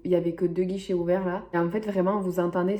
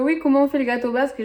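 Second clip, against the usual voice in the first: quick, higher excited question; imitating the guard; 6 semitones higher, 4 dB louder, faster than usual. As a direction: mimic the guard's eager curiosity. Garde demande la recette. (imitation enjouée et rapide du garde)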